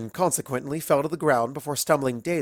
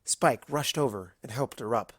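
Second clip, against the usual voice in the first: ordinary delivery, nothing marked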